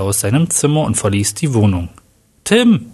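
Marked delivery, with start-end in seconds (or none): 2.4-2.9 high-pitched call after someone. A narrator's reading. Sabrina calls after her departing son, in a high cry. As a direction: cry the name out, much higher. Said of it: "schrie Sabrina ihrem Sohn hinterher".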